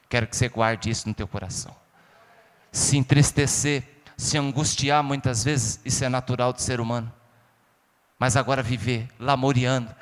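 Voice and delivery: low, soft, tender pastoral voice; soft intimate preaching. Acted. Preacher consoling grieving listeners, soft and low.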